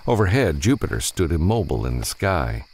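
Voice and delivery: ordinary delivery, nothing marked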